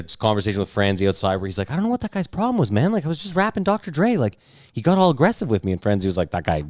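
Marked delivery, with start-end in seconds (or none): 1.7-5.7 high, fast indignant whine; whiny drunk rapper. Retold or imitated. Rapper complains to bouncer outside. 6.3-6.6 bouncer. Retold or imitated. Bouncer Franzi answers the rapper.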